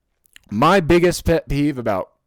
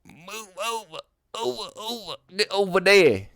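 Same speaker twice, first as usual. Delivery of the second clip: put-on bitchy Southern boy; 7 semitones higher than usual. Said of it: "I sound like a bitchy little Southern boy".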